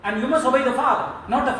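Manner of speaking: father forcing his will on his daughter, loud; loud insistence. Real, not acted. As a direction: lean hard on father with a loud laugh. Father insisting during family argument.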